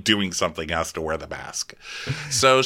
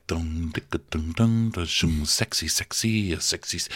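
beatboxing music; 3 semitones lower, 5 dB quieter than usual. Retold or imitated. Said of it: "the music is like".

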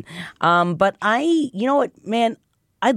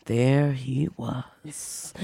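low slow seductive; 2 semitones lower, 6 dB quieter, much slower than usual. Retold or imitated. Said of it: "and just go".

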